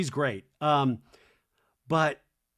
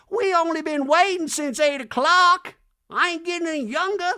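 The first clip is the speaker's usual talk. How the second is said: high, loud complaining [name]; mock [name], high and cutting; hammed-up loud high [name]; [name] impression; 8 dB louder than usual.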